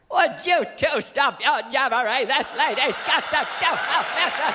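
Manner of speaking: high, slow put-on shout; scolding date. Date cuts off two men's chat, high scolding.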